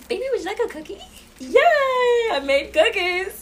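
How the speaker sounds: put-on high, sweet baby-talk to her son; doting mom. Mom spoiling her son after getting home, high sweet coo. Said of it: "I spoil him as soon as he gets home".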